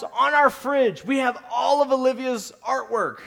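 ordinary delivery

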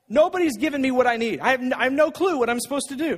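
normal talking voice

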